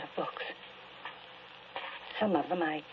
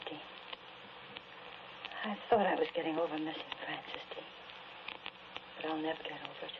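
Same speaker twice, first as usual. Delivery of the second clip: normal talking voice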